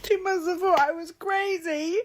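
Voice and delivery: very high, sob-choked voice; laugh-crying at herself; ugly crying. Real, not acted. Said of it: "I'm doing really ugly crying".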